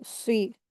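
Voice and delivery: "सी" with a thick, heavy s; heavy saad. Shown as a demonstration. Teacher demonstrating the heavy letter, thickened saad. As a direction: fill the mouth on the s of "सी". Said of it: "इसको heavy करके पढ़ेंगे".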